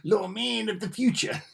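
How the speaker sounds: high, rising announcer flourish; playful trailer voice; dramatic title read. Pretend. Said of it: "Don't know why I said it like that".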